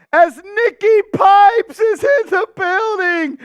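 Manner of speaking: loud, high hype announcement; excited shout-out. Real, not acted. Hyping a viewer's arrival.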